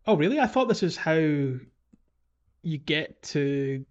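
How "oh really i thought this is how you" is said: ordinary talk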